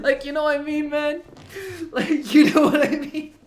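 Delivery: high, loud, laughing through the words; laughing plea. Real, not acted. Comedian pleading with the crowd while laughing hard. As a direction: beg for agreement while cracking up.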